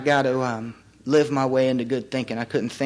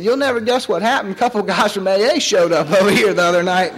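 retold dad, voice jumping higher and louder; excited news; 5 semitones higher, 5 dB louder than usual. Retelling his father's excited phone call, a bit higher and louder. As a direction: pass on the surprise as dad did, lightly acted.

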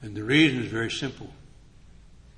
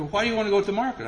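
high, fast puzzled wife; wife asking, high and fast; wife's quick question; 9 semitones higher, 3 dB louder, much faster than usual. Retold or imitated. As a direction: rattle it off as his wife.

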